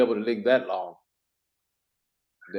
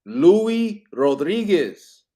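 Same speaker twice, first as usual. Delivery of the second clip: very slow, higher, drawn-out name; exaggerated slow enunciation; 5 semitones higher, 4 dB louder, much slower than usual. Real, not acted. Host repeating a name to a guest who missed it, slow enunciation. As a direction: stretch each word so he finally gets it.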